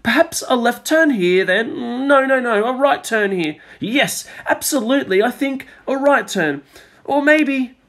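lost guide arguing with himself over the map; dithering guide. A narrator's reading. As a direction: fuss back and forth over the route.

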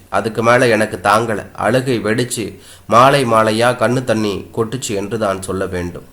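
usual speaking voice

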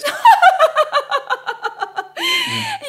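high scornful peal of laughter; mocking laugh. Widow laughing at men's faithfulness, mocking laugh. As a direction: laugh in his face. Widow mocks his claim.

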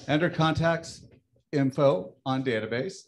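usual speaking voice

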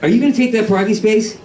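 high-pitched, feeble mock anger; badly faked LA indignation; pretend anger. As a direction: imitate someone only playing at being angry. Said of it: "Out here they pretend to be angry".

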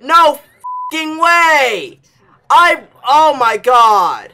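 high, drawn-out loud yell; real rage. Real, not acted. Gamer falling back down, enraged disbelieving yell. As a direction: wail it loudly in disbelief. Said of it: "this rage that you're hearing is real".

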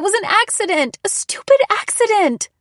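very high, agitated protest; crying out. A narrator's reading. Daughter defending herself over the lamp, crying out. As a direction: cry out in frantic self-defense. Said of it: "I cried".

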